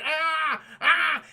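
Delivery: overacted trapped screams; mock panicked cries. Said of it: "He's like".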